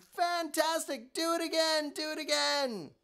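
high, loud cheering; excited shout. Acted. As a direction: cheer him on to go again. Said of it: "Elizabeth shouted".

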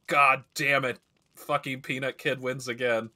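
hammed-up grumbling curse; mock frustrated outburst. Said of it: "they're like".

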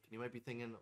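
ordinary delivery

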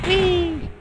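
high gleeful whee; high falling whee. Real, not acted. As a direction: fling it out with glee. Flying again.

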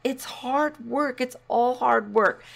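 ordinary talk

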